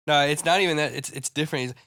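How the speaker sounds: usual speaking voice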